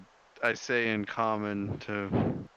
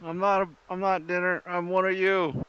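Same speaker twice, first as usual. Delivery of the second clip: high, pleading shout; acted orcish shout; 9 semitones higher than usual. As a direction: call out in protest, pitched well up. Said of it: "I kinda shout in orcish".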